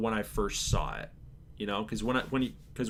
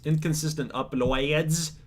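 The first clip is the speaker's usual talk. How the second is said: ordinary delivery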